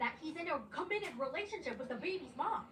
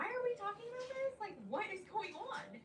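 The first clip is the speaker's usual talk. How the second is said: much higher, incredulous voice; confused girl asking why this is happening; baffled Chloe; 11 semitones higher than usual. Retold or imitated. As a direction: squeal your confusion.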